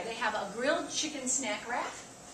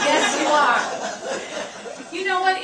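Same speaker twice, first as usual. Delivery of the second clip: loud playful teasing; 4 semitones higher, 13 dB louder than usual. Real, not acted. Nutrition teacher teasing a heckler, loud and playful. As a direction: call it out louder, playful. Teasing the audience member back.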